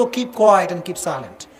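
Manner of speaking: very slow, every word drawn out. Shown as a demonstration. Preacher advising wives, very slow. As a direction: spell it out slowly to the wives. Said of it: "teaching wives to stay quiet".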